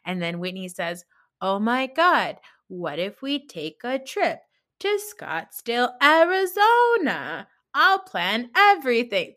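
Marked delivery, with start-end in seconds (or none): 1.4-9.3 higher, louder, gushing put-on cheer with a slow, soaring Arizona; mocking Whitney's fake-nice pitch for a trip; mock fake enthusiasm. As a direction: oversell it like a fake-sweet party planner. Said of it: "So fake".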